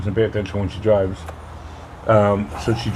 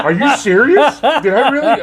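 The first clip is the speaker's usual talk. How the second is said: high, laughing, louder squeal; laughing mock disbelief; 6 dB louder than usual. Mom's mock shock, voiced high and laughing. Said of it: "I was like".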